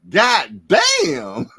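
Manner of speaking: loud, high acted exclamation; shocked boxer yelling God damn; mock shout. Acted quote of boxer's reaction.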